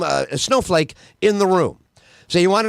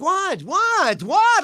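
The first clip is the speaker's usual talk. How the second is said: higher, rising bewildered "what"; mock indignant what; 10 semitones higher, much slower than usual. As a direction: act outraged in disbelief. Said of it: "No, we're like".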